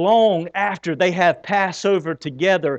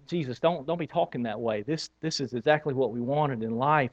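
much softer, low, pleading protest; hushed pleading; 4 semitones lower, 10 dB quieter than usual. Retold or imitated. Disciples begging Jesus to stop talking of death, hushed. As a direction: plead quietly with him not to say it. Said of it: "They're like".

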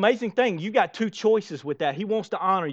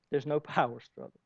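much softer echo of the line; hushed restatement; soft repeat; 4 semitones lower, 10 dB quieter than usual. Shown as a demonstration. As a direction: let it sink in quietly.

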